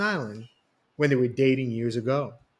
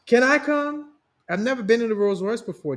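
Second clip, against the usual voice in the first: higher, louder and fast, eager; a bride thrilled to ride in the Rolls; acted excitement; 9 semitones higher, 5 dB louder than usual. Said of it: "Denisha said excitedly".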